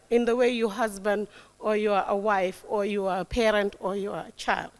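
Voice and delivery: usual speaking voice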